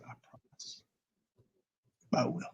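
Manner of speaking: near-silent, hushed vow; whispered promise. Retold or imitated. Promising the dying man to care for Joshua, hushed whisper. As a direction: breathe the promise softly.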